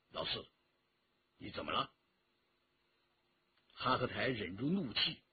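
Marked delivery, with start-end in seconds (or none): 0.1-1.9 very low, hushed check; restraining a companion. A narrator's reading. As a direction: pull him back with a quiet, deep voice. Leader restrains his angry companion.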